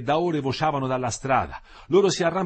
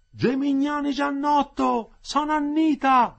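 high, slow, carrying yell; woman shouting out; 6 dB louder, slower than usual. A narrator's reading. Fourth woman calling to a prisoner, shouting. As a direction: call out the name, drawing it out. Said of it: "gridò la quarta".

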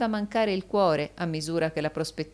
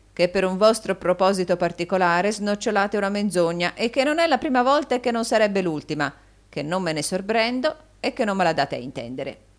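cold; 5 semitones higher, 6 dB louder than usual. A narrator's reading. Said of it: "rispose Rodolfo guardandolo freddamente".

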